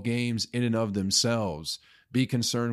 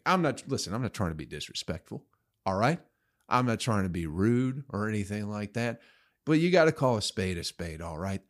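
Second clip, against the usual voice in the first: ordinary talk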